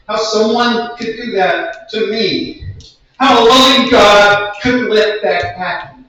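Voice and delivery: intense spoken word, building in force; anguished performance poem. Acted. Survivor asks how God could let that happen, anguished and building. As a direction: press each question harder, building to an outcry.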